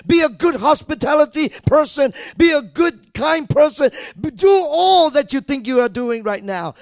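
loud, high, ringing preacher's cry; shouting exhortation to the church; full-force preaching. Acted. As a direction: preach it out at the top of your voice.

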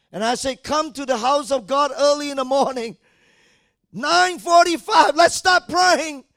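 preacher calling church to early prayer, full-force preaching. Acted. Summon the congregation loudly.

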